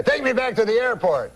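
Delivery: put-on high-pitched French-Canadian accent; insistent uncle with a French-Canadian accent. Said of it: "So, uh, he's like".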